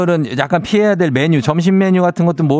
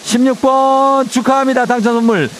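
booming prize announcer; 8 semitones higher than usual. Real, not acted. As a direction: hype up the prize draw like a host. Radio host announcing prize draw. (우렁찬 경품 발표 목소리)

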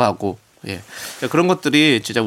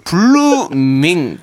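song title announcement; 5 semitones higher, 5 dB louder, much slower than usual. Acted.

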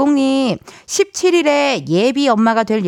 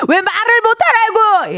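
drama quote; 11 semitones higher than usual. Retold or imitated. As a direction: overplay the famous drama line. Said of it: "명대사를 한번 따라해봤습니다".

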